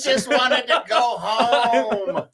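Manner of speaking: high, slow, laughing through it; laughing plea. Real, not acted. Tired roadie telling friends about autographs, laughing through the words. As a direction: laugh out the complaint.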